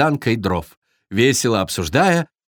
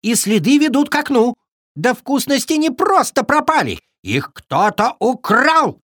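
high, indignant squirrel voice; alarmed exclamation; 13 semitones higher than usual. A narrator's reading. Squirrel tells friends of the theft, exclaiming in alarm. Squirrel spots the thief's tracks.

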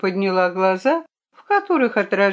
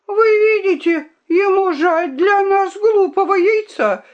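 very high, raised indignant squeal; shrill squeak; 12 semitones higher, 4 dB louder than usual. A narrator's reading. The princess mocks his care for the egg, squeaking. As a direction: squeak it out in outrage. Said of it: "пискнула королевна".

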